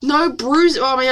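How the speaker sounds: normal talking voice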